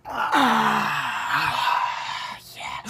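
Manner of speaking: long noisy tokes around a groaned "yeah"; heavy puffing and blissful groan; very stoned director. Acted. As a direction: imitate the highest smoker in the room. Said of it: "the most high out of all three".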